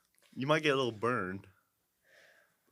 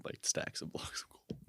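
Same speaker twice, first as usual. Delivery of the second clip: whispered; 15 dB quieter, slower than usual. Real, not acted. Trailing off.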